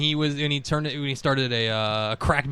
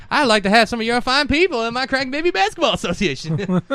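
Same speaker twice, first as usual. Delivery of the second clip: Cartman voice; 8 semitones higher than usual. Retold or imitated. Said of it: "It's like".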